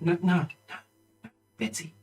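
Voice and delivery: hushed, urgent correction; small voice, trick going wrong; embarrassed young trainer. Acted. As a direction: quietly plead with the dog. Girl's dog trick is failing.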